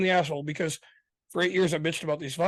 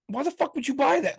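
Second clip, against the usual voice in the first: exasperated outburst; 10 semitones higher than usual. Retold or imitated. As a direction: throw the question back at her, fed up. Retelling the argument with his wife.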